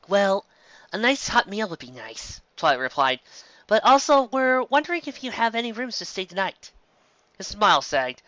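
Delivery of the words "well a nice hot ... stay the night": put-on higher, halting young mare; hiding the real plan; hesitant Twilight. Twilight evasively answers the bartender.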